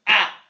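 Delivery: "آ" like an alef with a fatha; short-a letter sound. Shown as a demonstration. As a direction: say "آ" as an open "ah". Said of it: "هقراها إزاي؟".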